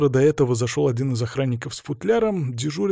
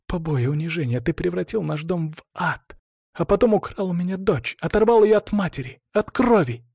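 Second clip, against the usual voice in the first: accusation climbing higher phrase by phrase; bitter outpouring; 6 semitones higher than usual. A narrator's reading. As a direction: build from contained hurt to high outrage. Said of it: "С каждой фразой она будто сбрасывала". (обвинение с нарастающим надрывом)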